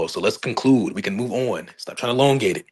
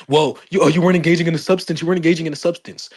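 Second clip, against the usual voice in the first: mocking critics; 6 semitones higher than usual. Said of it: "people were saying that".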